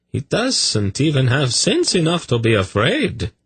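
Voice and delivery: high-pitched, swooping character voice; exasperated defending master. Acted. Master defending his servant to the hierarch, high and scornful. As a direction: dismiss the servant as a dunce in high, lilting tones.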